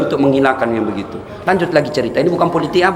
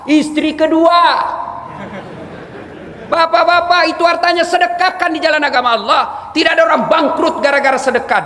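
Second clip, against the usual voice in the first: higher, fervent sermon voice, each question driven home; preaching at full force; 10 semitones higher than usual. Acted. Preacher urging the congregation to give alms, fervent preaching. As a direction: rouse the crowd to give.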